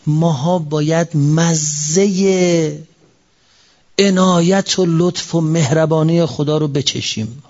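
drawn out, word by word; slow fervent preaching. Acted. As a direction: savour each word slowly.

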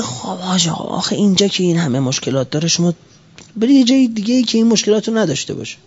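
lightly voiced dismissive official; visiting official. Visiting official advising the volunteers to go elsewhere, lightly imitated. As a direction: put on a hint of the official's voice. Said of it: "اون آقای مسئولی که اومده سر بزنه".

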